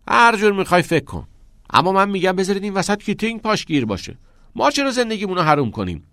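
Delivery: high, quick, heated retort; defiant argument. A narrator's reading. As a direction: push back insistently. Dismissive arguing in the quarrel.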